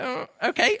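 high, hesitant okay with a rising end; doubtful acceptance. Retold or imitated. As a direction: go up high, unconvinced but going along. Acting own puzzled reaction to accent.

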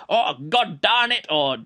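mock old-timey cartoon swearing; cartoony cursing. Reviewer imitating quaint swearing from the book, cartoony cursing. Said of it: "it felt much more cartoony".

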